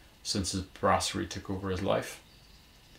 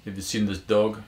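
put-on louder, Eastern European accent; 6 dB louder than usual. A narrator's reading. Burly stranger showing a dog's picture, Eastern European accent. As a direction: question him in a strong Eastern European accent. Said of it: "in a strong Eastern European accent".

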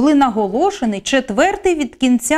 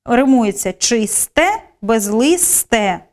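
slow emphasis; slower than usual. Shown as a demonstration. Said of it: "Спостерігаємо".

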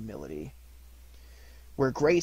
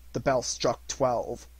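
ordinary delivery

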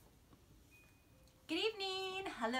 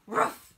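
loud mock bark; dog bark; 10 dB louder than usual. Dog sound in story.

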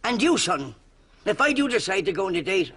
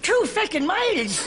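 aghast disbelief; 12 semitones higher, slower than usual. Acted. Aghast at walking two miles.

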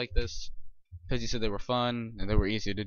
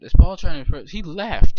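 alarmed; 5 semitones higher, 13 dB louder than usual. Real, not acted. Alarmed over Paul.

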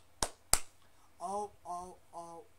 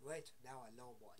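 usual speaking voice

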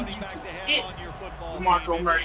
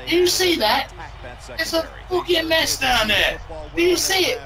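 higher mock British chef tirade; Gordon Ramsay impression; 7 semitones higher than usual. Gordon Ramsay berating the French restaurant owner in British accent. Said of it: "He was like".

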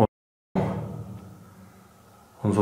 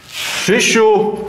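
calling from far away; 7 semitones higher than usual. Real, not acted. Calling lost teammate.

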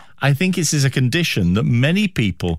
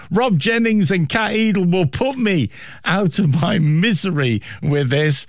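ordinary talk

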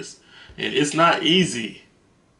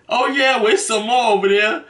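retold hostess reply; 9 semitones higher, 4 dB louder than usual. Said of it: "She said".

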